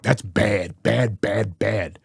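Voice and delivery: low, soft mock of Trump's "bad, bad, bad". Mocking Trump's repeated words.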